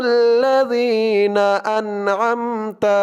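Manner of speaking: slow tajweed chant, vowels held long; Quran recitation. Shown as a demonstration. Teacher modelling the verse for learners, slow Quran recitation. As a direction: recite it slowly and measured, syllables drawn out.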